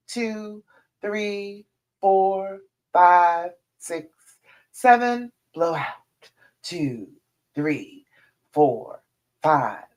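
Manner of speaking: slow, spaced-out count for breathing; guided breathing count. Shown as a demonstration. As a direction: lead them through each breath, one held word at a time. Leads a breathing exercise.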